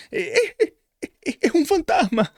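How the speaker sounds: high-pitched frightened yelp, stammering; terrified cry. Retold or imitated. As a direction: squeal it out like a terrified fisherman seeing a ghost. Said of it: "aterrados, llenos de miedo, y exclamaron". (grito aterrado y agudo)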